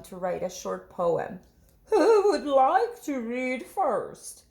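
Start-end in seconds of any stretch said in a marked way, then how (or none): 1.8-4.4 higher, slow teacher's question. Acted. Miss Hart asking her class, slow higher teacher voice. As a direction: ask the class like a kind teacher.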